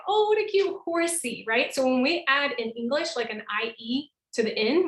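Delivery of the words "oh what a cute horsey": "horsey" said fondly, pitched up; cute, high and sweet. Shown as a demonstration. Teacher showing the cutesy form of the word. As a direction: coo over a cute little horse. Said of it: "I can say".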